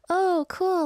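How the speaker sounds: light, retold casual approval; breezy reaction. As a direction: play back an easy, agreeable reaction. Retells own casual reply.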